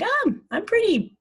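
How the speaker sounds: a light mock preen to herself; delighted little self-compliment; pleased with herself. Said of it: "I'm like".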